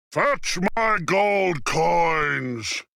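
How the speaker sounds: slow commanding giant; giant roaring. A narrator's reading. Giant ordering his wife, slow commanding roar. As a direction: order it out like a tyrant. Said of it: "Then he roared".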